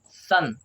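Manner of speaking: short neutral vowel in सन, rhyming with fun; correct vowel. Shown as a demonstration. Teacher shows pupils how s-o-n is read, short vowel. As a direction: say सन with a short 'uh', like sun. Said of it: "ओ का उच्चारण आ में".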